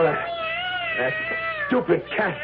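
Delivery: high furious yell of "stupid cat". Acted. Phil raging at the cat in the basement. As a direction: spit it out in rage. Man chasing cat in rage.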